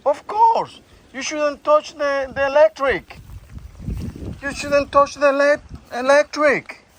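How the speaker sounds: high, emphatic telling-off; alarmed scolding. Real, not acted. Owner to shocked dog Bambi, high anxious scolding.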